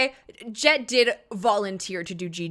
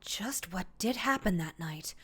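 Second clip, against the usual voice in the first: breathy whispered question; 5 dB quieter than usual. Real, not acted. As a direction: whisper it as an aside. Whispered aside to the other player.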